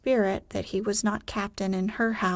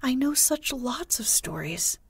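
higher-pitched, coaxing plea; entreating; 5 semitones higher than usual. A narrator's reading. Wendy offers Peter stories to keep him, entreating. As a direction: tempt him back with an offer. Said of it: "she entreated".

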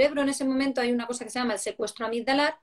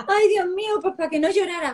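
flustered exclamation; 7 semitones higher, 4 dB louder than usual. Retold or imitated. Mother's flustered reply in story.

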